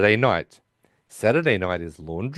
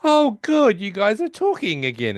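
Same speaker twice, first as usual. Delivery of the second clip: much higher, bright and loud relief; cheery relief; 10 semitones higher, 3 dB louder than usual. Retold or imitated. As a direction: chirp it out, relieved. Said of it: "He's like".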